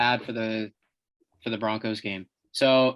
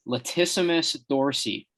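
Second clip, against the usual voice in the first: ordinary delivery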